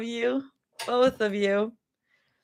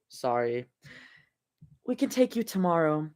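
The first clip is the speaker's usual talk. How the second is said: ordinary delivery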